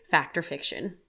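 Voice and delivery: ordinary delivery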